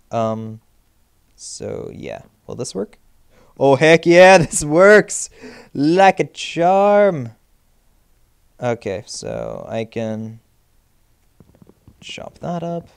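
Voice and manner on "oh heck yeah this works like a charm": high loud delighted cheer, laughing; excited. Real, not acted. Gamer cheering that the build works. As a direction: crow over the success.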